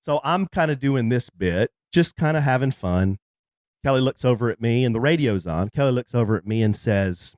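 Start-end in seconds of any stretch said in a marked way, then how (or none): none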